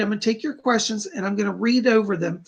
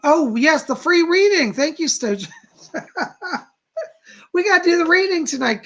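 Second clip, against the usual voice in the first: excited; 8 semitones higher, 5 dB louder than usual. Real, not acted. Excited about the free reading draw.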